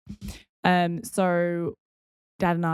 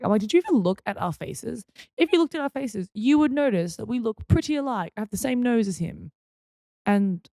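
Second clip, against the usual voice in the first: mildly indignant, higher-pitched protest; mock indignation; 5 semitones higher than usual. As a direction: argue your case in playful disbelief. Acting out protest at being mistaken.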